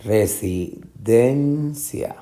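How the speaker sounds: very slow Residencia with DEN held and rising; syllable by syllable. Shown as a demonstration. Teacher confirming tonic syllable, resi, DEN, cia. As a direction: break up Residencia, stretching DEN. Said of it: "den es la sílaba".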